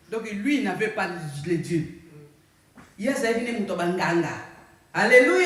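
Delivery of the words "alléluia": loud rising call of alleluia; shouted alleluia. Real, not acted. Preacher rousing the congregation with a loud call. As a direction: call it out high to lift the room.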